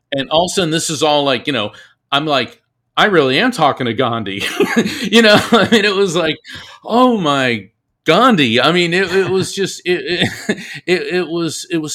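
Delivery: bubbling over with amazed laughter; breathless, delighted stammering; giddy excitement. Real, not acted. Overwhelmed, laughing about talking to Gandhi.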